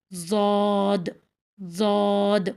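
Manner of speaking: long heavy زاد with dark, full vowel like "zood"; heavy, mouth unspread. Shown as a demonstration. Teacher turns the practice sound into Daad, long heavy زاد. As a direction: hold زاد emphatic, mouth not spread. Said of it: "جب منہ چوڑا نہیں کریں گے تو automatically یہ حرف ادا ہوگا".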